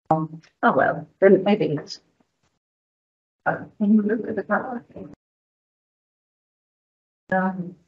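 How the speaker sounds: very quiet, half to oneself; muttered aside. Real, not acted. Presenter fumbling to find the map on screen, muttered aside. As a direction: talk softly off to the side while searching.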